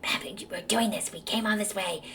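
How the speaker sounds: hissed angry whisper; fierce hushed marital spat; whisper fight. Retold or imitated. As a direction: argue in a furious whisper. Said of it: "have one of those whisper fights".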